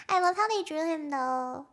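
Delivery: normal talking voice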